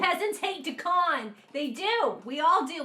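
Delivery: loud, high rallying shout; shouting as one of the hating peasants; mock peasant outcry. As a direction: yell it like an angry villager.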